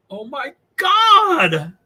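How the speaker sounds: high, loud, drawn-out gasp of delight; delighted shock at a chat reply, high and slow; stunned excitement. Real, not acted. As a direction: let it out slow and thrilled.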